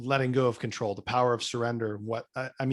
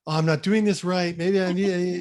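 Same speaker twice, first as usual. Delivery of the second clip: higher, louder fretting inner voice; mock self-doubt; 7 semitones higher, 6 dB louder than usual. Struggling breathwork student voices her self-doubt, mock fretting. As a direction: fret out loud, pitched up in mock panic.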